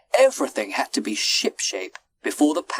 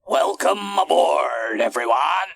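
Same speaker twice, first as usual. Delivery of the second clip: captain's welcome; 5 semitones higher, 4 dB louder than usual. A narrator's reading. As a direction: welcome the whole crowd aboard. Captain greets his passengers.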